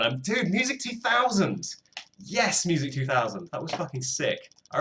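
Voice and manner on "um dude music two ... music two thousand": much higher, thrilled cry of recognition; excited recognition. Real, not acted. Recognizes a nostalgic PlayStation game.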